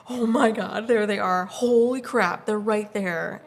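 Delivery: loud, gasping awe; reenacted fan freak-out; starstruck. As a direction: gush loudly, amazed they're so close. Starstruck on seeing the players.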